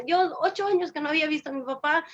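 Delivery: ordinary talk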